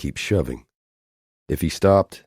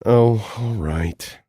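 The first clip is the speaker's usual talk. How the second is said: grumbling; 7 semitones higher, much slower than usual. Acted. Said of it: "another grumble".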